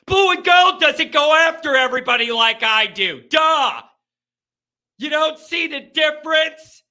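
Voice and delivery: loud heated shout; angry. Real, not acted. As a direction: snap back at the critic. Heated rebuttal to listener.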